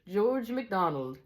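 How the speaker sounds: slow, high Scottish character voice; lightly voiced Scottish teacher. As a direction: introduce yourself slowly as a storybook Scotsman.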